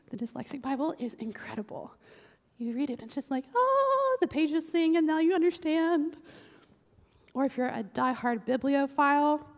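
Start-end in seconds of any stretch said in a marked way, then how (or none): 3.5-6.2 high, loud swooning "Oh" into gushing wonder; rapturous delight. Retold or imitated. Dyslexic reader gushing to the church about the new Bible. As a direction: gush like the pages just opened up.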